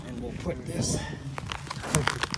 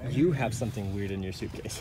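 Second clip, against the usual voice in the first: hushed, softer question; whispering over a crib; 9 semitones lower, 8 dB quieter than usual. Real, not acted. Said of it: "We gotta be quiet".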